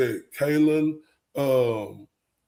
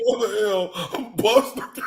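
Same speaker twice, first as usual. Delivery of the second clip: mock self quote; 7 semitones higher than usual. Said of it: "I was like".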